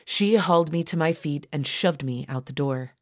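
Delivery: usual speaking voice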